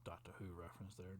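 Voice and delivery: hushed, quick little whisper; whispered aside. Real, not acted. Storyteller whispers a side joke to the viewers. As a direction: slip it in under your breath, fast.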